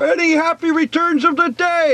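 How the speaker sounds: high cheerful call across the river. Acted. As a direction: shout it over the water. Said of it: "called out Pooh".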